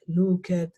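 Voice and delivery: "looked" in two syllables, "loo-ked"; pronounced as spelt. Shown as a demonstration. Teacher shows learners' expected "looked", read as two syllables. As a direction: sound out the E in "looked". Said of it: "se pronuncia tal y como se ve".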